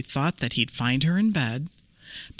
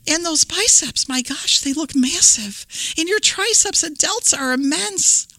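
put-on amazed little girl; 10 semitones higher than usual. Red marvels at granny.

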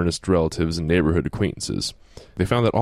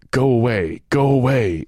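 hissing voice; 5 semitones higher, 4 dB louder than usual. Retold or imitated. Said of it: "in a hissing voice".

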